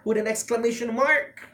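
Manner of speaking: ordinary talk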